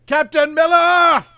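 high, slow, desperate yelling; panicked movie shout. Retold or imitated. Podcaster shouting a horror movie line at his cohost, panicked yell. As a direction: scream it out like a terrified captain calling for help. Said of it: "Doctor Weird's great line".

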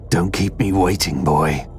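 rough tough-man voice, falling low; eager eye-patched man. A narrator's reading. Eye-patched man awaits the envelope, gruffly eager. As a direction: hurry the lad along in character. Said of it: "making no attempt to hide his excitement".

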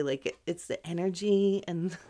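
ordinary talk, nothing marked

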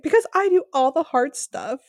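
imitating an aggrieved comeback; exasperated retort. [name] snapping back at Jonathan, mock exasperated.

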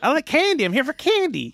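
put-on squeaky kid begging at a candy store. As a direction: play an eager little boy asking for candy. Child role in improv skit.

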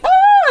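short high-pitched shriek; sudden loud yelp of fright; mock scream. Imitating a woman fleeing the man, mock scream. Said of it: "and she's like".

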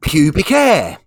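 high, loud, proud answer; blunt schoolboy. Acted. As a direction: blurt it out to the whole class. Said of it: "little Johnny holds his hand up".

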